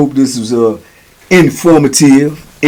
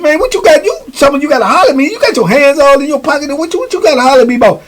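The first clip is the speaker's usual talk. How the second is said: high, agitated retold demand; agitated confrontation; 10 semitones higher, faster than usual. Batter to man with hidden hands, high agitated demand. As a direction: demand answers, pitch held high throughout.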